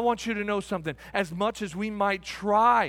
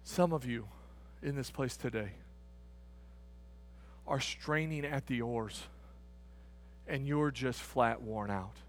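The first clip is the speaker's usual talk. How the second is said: hushed; 13 semitones lower, 13 dB quieter than usual. Real, not acted. Preacher to worn-out people in the congregation, hushed. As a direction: drop right down, quiet and grave. Preacher turns gently to the weary.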